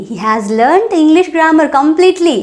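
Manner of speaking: each word slowly and separately for learners; deliberate teacher pacing; slow model sentence. Shown as a demonstration. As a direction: spell it out slowly for the class. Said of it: "teacher models the sentence".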